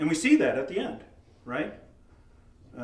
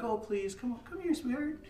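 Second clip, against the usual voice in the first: soft, high, sugary pleading; mock-gentle, too-nice parent; 8 semitones higher, 8 dB quieter than usual. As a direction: coo sweetly as if to a small child. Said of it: "a nice tone of voice".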